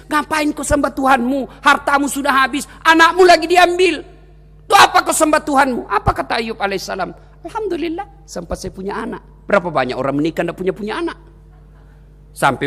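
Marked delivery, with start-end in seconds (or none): 0.1-5.8 raised, pressing devil's taunt; goading devil. Acted. Devil tempting Ayub after his children die, goading. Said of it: "datang lagi iblis". 7.5-9.2 soft, accepting reply; calm, contented. Acted. Ayub answering the devil after losing his children, calm. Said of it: "Tenang perasaan".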